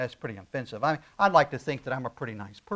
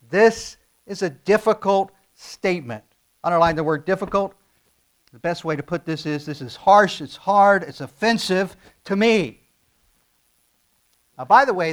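higher and louder, pressing hard; full-force preaching; 3 semitones higher, 9 dB louder than usual. Acted. Pastor presses the congregation, preaching at full force. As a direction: preach it, lean into every word.

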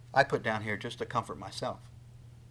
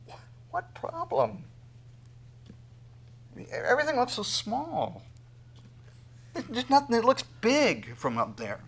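amazed wonder; 11 semitones higher than usual. Pretend. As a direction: marvel like looking down from a plane. Believer soaring, looking down amazed.

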